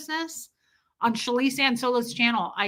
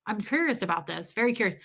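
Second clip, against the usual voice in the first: ordinary talk, nothing marked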